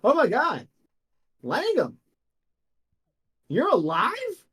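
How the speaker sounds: high, loud startled cry; shocked guard seeing a dead man walk in. Acted. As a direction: gasp it out like you've seen a ghost. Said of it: "And you see he goes".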